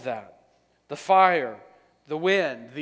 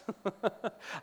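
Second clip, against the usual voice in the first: breathy laugh; 6 semitones higher, 8 dB quieter than usual. Real, not acted. Laughs mid-quote.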